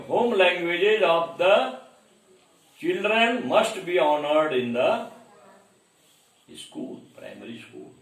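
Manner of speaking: slow, deliberate dictation of a quote. Acted. Teacher reading the exam line to students, slow dictation. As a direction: spell it out slowly so students can write.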